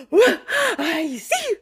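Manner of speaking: gasp, then high playful peekaboo burst; over-the-top high squeal; big and loud. Retold or imitated. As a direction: re-enact startling a baby with peekaboo. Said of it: "really big and loud like that".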